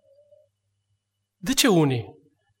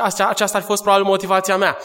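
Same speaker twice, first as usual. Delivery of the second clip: usual speaking voice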